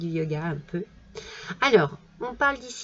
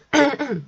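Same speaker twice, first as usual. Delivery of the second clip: throat clearing; 5 semitones higher, 6 dB louder than usual. Real, not acted. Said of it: "Alors, euh".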